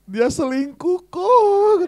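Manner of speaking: high, slow hammed-up sob; mock crying. Pastor imitating his maid sobbing that her boyfriend cheated, hammed-up. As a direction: wail it out like a jilted girl.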